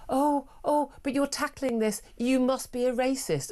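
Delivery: high-pitched mock scandalised accusation; mock outrage. Radio host mimicking a passenger's knee-jerk reaction, mock outrage. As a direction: parody a shocked do-gooder, pitched well up.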